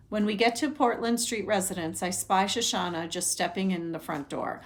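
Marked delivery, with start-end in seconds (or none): none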